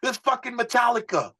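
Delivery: high, put-on voice of the gruff mentor; imitating Johnny. Manager scolding the young singer, high put-on voice. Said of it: "He'd be like".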